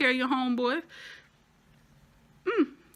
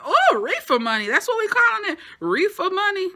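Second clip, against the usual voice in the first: high, loud sassy disbelief; mock incredulous; 10 semitones higher, 8 dB louder than usual. Viewer mocking the mom's phrase, high sassy disbelief. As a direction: tease the phrase with sass. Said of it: "I said".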